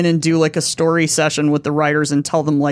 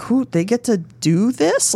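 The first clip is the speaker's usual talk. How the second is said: incredulous; 6 semitones higher, slower than usual. Retold or imitated. Retelling shock at notes givers.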